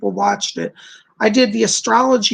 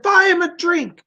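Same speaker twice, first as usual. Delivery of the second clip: lightly imitated RAF veteran, pitched high; high-pitched mock-British quote; British airmen's voice; 8 semitones higher than usual. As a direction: play a British pilot calling out an order. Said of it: "They said".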